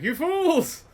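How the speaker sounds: loud, high hammed-up warning cry; mock doom prophet shout. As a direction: play a wild-eyed park doomsayer yelling at riders. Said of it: "just shouting, like".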